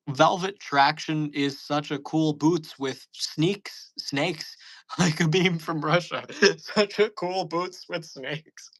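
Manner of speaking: slow, breaking into laughter; laughing read-through. Shown as a demonstration. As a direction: read it again but crack up.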